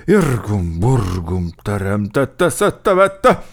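comic stern cursing; mock stern. A narrator's reading. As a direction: bluster like a strict chief. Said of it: "igen szigorú ábrázattal hozzátette".

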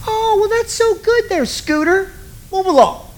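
high, mock-impressed cooing; mock praise. Preacher mocking the rich young ruler's boast, sarcastically sweet.

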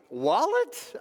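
high, rising baffled question; mock disbelief. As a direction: play the clueless traveler, incredulous. Acts baffled traveler at security.

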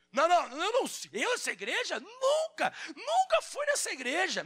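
high, hammed-up denial; mock denial. Backslider denying his church to worldly friends, hammed-up and high. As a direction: ham up a backslider's panicked no.

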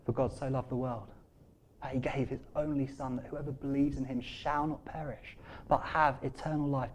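low, soft verse reading; quiet scripture recital. Real, not acted. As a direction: recite it gently and reverently. Recites famous verse after a pause.

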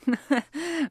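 short airy laugh; a quick fond chuckle; light laugh. A narrator's reading. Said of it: "Roman laughed".

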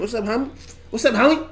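soft "homie" said as HAH-mee; Cali "homie". Acted. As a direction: toss off "homie" with an open "ah".

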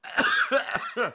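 breathless giggling fit; helpless laughter. Real, not acted. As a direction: laugh until you can't talk. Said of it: "I can't stop laughing".

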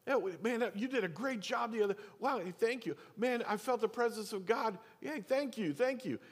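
imitating people fawning over the worship leader, pitched far up; mock gushing praise. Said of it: "there was a time where it was like".

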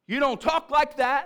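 lightly acted stern father; mock fatherly scolding. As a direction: scold like a dad laying down the law. Father scolding son in story.

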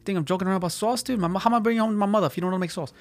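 high, fast, indignant impression; dead-serious movie guy. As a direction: mimic an offended guy rattling it off. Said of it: "he's dead serious, dude. He's like".

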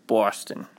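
"Boston" in a Boston accent. Shown as a demonstration. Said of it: "I can use the Boston accent".

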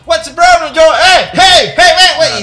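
high, loud, taunting shouts of hey; mock a gloating American dad yelling at his kid; mocking gringo prank dad. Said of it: "los gringos culeros dicen".

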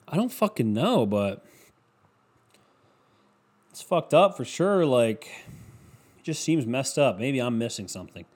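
usual speaking voice

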